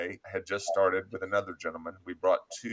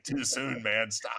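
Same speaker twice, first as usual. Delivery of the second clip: mock protest; 2 semitones higher, slower than usual. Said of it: "And I was like".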